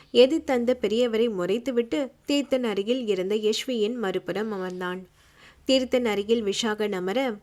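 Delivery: usual speaking voice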